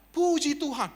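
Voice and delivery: loud, high retold exclamation; mock enthusiastic praise. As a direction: imitate a suddenly delighted greeting. Landlord's greeting suddenly changes.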